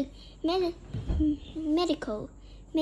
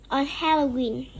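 ordinary delivery, nothing marked

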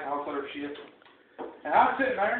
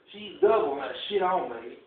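normal talking voice

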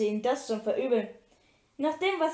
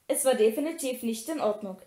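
ordinary delivery, nothing marked

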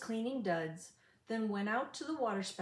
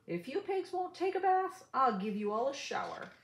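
gruff farmer threat; 5 semitones higher than usual. Acted. Farmer threatens pigs with hose.

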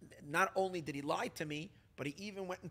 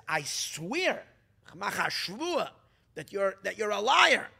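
loud, higher oath, hurled as an accusation; loud sworn accusation; 4 semitones higher, 10 dB louder, slower than usual. Retold or imitated. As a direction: swear it out loud in front of everyone. Public oath accusing a liar.

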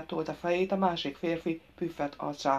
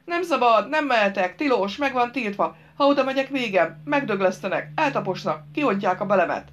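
loud, higher alarmed fool; village simpleton, panicking; 5 semitones higher, 7 dB louder than usual. Acted. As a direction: blurt out the dreadful warnings in a fright.

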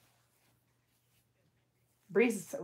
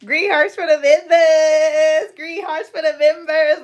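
much louder and higher, slow stretched; hyped shoutout to channel members; loud sing-song callout; 9 semitones higher, 13 dB louder, slower than usual. Real, not acted. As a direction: call it out like a livestream shoutout. Calls out to viewer on stream.

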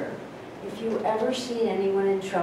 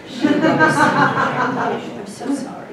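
laughing; 7 semitones higher, 11 dB louder, slower than usual. Real, not acted. Laughing while telling about her.

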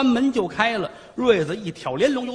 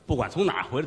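plain reading voice

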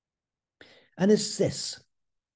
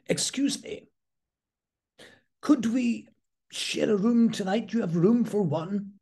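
politely posh, lifted well up; 6 semitones higher than usual. Retold or imitated. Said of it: "a very posh voice said".

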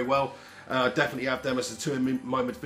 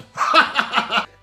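high, loud burst of laughter; 8 semitones higher, 7 dB louder than usual. Real, not acted. Host after insulting rival fans, loud laugh. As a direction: cackle at your own joke.

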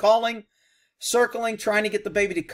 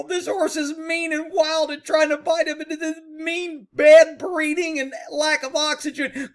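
high, loud mocking rescuer voice; mock horse woman; 10 semitones higher, 5 dB louder than usual. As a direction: ham up a frantic horse lady. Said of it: "Who do I sound like?".

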